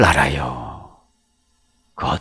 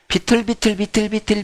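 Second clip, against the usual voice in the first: high, soft sing-along patter; mimed staggering; 7 semitones higher, 5 dB quieter than usual. Acted. Preacher mimics the old serpent staggering up, mimed staggering. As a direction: patter it lightly and high, like wobbling steps.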